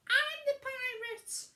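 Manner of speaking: high, loud wailing witch; Winnie voice. A narrator's reading. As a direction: wail in dismay. Winnie shocked.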